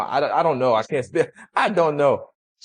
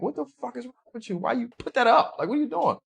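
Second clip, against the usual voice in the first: high, disbelieving retold outcry; incredulous protest; 13 semitones higher, faster than usual. Guest recalls protesting in the car, pitched-up disbelief. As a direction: ask in high mock disbelief. Retelling his shocked reaction again.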